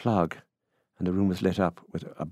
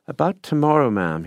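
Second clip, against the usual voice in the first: high, loud offhand opener; 8 semitones higher, 7 dB louder than usual. A narrator's reading. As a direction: raise it casually, then drop on "ma'am". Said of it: "somewhat offhandedly".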